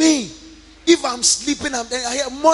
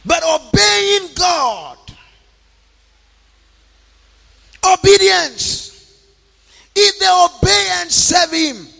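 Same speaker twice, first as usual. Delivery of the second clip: preaching; 7 semitones higher, 5 dB louder, slower than usual. Acted. Preaching to the congregation.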